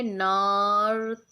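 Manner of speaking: नर्तक drawn out slowly, syllable by syllable; slow teacher demo of नर्तक. Shown as a demonstration. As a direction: stretch नर्तक out for the children. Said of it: "slowly बोलोगे तो क्या बोलोगे?".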